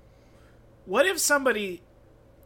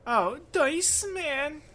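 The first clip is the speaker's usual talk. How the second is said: nasally voice; 8 semitones higher, much slower than usual. Pretend. Mimicking Dice Man's wife's voice.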